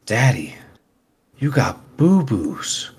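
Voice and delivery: lightly voiced child, slow and drawn out; imitated little kid. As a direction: imitate a small child talking slowly to Daddy. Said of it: "my kid walks up to me and he goes".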